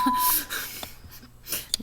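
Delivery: sudden high burst of laughter; amused laugh. Real, not acted. Commentator reacting to his sense of humor, amused laugh. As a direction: laugh out loud, high and breathy.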